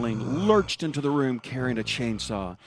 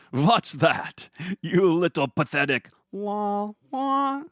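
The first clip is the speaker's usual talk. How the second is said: higher, slow sneering mockery; mocking villain; 11 semitones higher, 4 dB louder, slower than usual. Gargamel mocking the weak Billy's little la la. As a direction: drag out the insult slowly and high.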